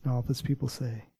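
soft, earnest prayer voice, partly whispered. Real, not acted. Preacher praying softly over the congregation after the sermon. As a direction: pray gently over the congregation.

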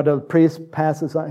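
ordinary talk, nothing marked

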